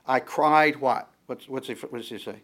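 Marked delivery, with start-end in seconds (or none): 0.1-0.8 "cried" punched loud; emphatic verse quote, "cried" hit loudly; stress on cried. Shown as a demonstration. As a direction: land hard and loud on "cried". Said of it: "the emphasis is gonna be".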